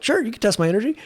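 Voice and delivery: high cheery agreement; all smiles. Retold or imitated. To the girl asking to test his energy, all smiles. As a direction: sound eager and sweet. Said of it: "Just all smiles".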